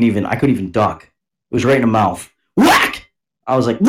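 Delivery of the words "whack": very high, sudden whack noise; sharp mimed hit; imitated smack. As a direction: act out the slap as a sound effect. Imitating the backhand to mouth.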